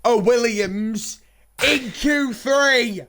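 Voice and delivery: slow, spaced-out words with pauses; incredulous emphasis. Real, not acted. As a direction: spell out the astonishing news slowly. Repeats the surprise result, incredulous.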